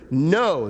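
loud, emphatic "No," punched out. Retold or imitated. Pastor acting the Israelites refusing God, emphatic. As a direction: hit "No," like a refusal. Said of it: "It's emphatic".

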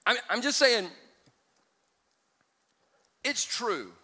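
ordinary delivery

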